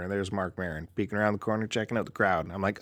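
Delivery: normal talking voice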